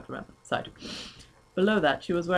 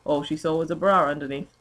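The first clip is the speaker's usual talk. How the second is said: ordinary delivery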